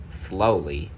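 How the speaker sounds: usual speaking voice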